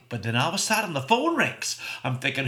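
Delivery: ordinary delivery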